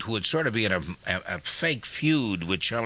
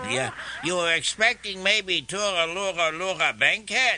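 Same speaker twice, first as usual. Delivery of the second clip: Mrs Nussbaum voice; 5 semitones higher than usual. Retold or imitated. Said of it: "who would say".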